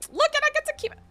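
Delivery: loud, high exclamations; excited. Real, not acted. Streamer delighted to keep the flower, excited. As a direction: burst out with real excitement.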